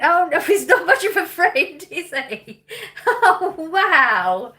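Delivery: talking through real laughter, high; laughing. Real, not acted. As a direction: laugh it out in disbelief. Laughing at a bad friend story.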